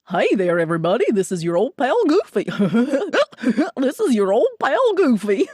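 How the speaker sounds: Goofy greeting rolling through a Goofy laugh; Goofy voice, laugh in the middle; Goofy impression with laugh. Shown as a demonstration. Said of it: "Do the words and the laugh".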